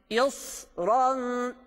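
"İsran" with a thick emphatic s and heavy r; heavy sad and ra. Shown as a demonstration. Teacher shows students the heavy s in "İsran". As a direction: say "İsran" thick and full, not thin. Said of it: "ince de okumuyoruz, kalın okuyoruz".

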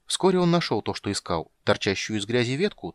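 usual speaking voice

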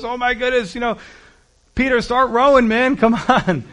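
high, loud, hammed-up panic of frightened men in a boat; mock panic. Preacher acting out the panicking disciples, loud and high. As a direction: play the scared disciples, half laughing.

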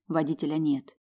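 ordinary delivery

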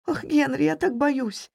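high, plaintive fearful voice; frightened. A narrator's reading. Wife tells her husband she is afraid, frightened.

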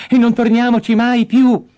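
loud, high-pitched shout; crying out. Acted. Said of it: "gridò". (grido forte e acuto)

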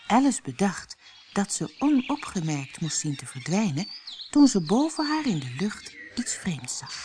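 plain reading voice

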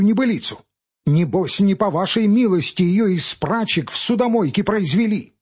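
high, heated scolding; indignant young man. Acted. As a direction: shame the old man, rising to a pitch. Pavel scolds old clerk.

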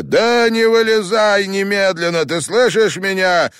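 very high and loud over the prayer; frantic shout. A narrator's reading. Father shouting to his son over the prayer, frantic and very high. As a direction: yell down into the grave.

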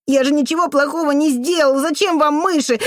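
put-on high, plaintive child's voice; tearful protest. A narrator's reading. Boy defending himself to the janitor, tearful. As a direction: protest innocence in a boy's whine.

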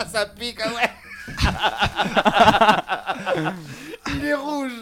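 talking through laughter; laughing hard while speaking. Real, not acted. Laughing at friend in pain.

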